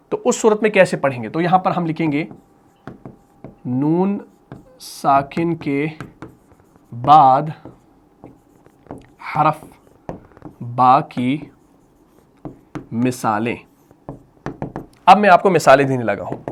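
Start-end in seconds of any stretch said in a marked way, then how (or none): 3.7-13.6 word by word, low and unhurried, long gaps; spaced-out low dictation; slow dictation. Real, not acted. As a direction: dictate slowly as you write it out. Dictating while writing on board.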